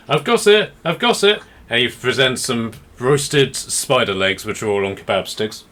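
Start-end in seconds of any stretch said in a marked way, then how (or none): 0.1-1.4 excited shout. Acted. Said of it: "He's like".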